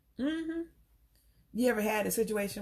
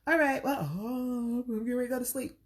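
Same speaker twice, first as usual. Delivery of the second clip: louder, higher, fake-bright dismissal; hint that he should leave now; pointed brush-off; 3 semitones higher, 3 dB louder than usual. Woman telling an unwanted guest she's going to sleep, pointedly. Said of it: "we got a little attitude".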